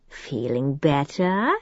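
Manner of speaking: soft, slow purr; silky soft slow tease; almost purring. A narrator's reading. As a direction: purr it at her softly, slowly. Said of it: "he almost purred".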